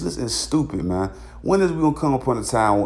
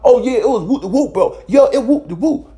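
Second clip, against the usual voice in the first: snitching gangster; 7 semitones higher than usual. Retold or imitated.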